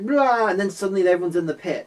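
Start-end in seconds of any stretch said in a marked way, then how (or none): 0.1-0.5 short, louder imitated roar; mock roar. Retelling someone leaping from the dark, mock roar. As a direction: let out a sudden mock roar. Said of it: "It's like".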